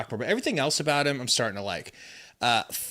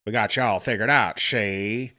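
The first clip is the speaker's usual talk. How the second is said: lightly hammed-up gangster voice; mock mobster impression; old movie mobster; 4 dB louder than usual. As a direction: play a cartoon crook taunting the police. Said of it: "That's what he looks like".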